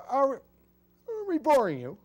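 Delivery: high, slow indignant voice; hammed-up hurt pride; mock offended.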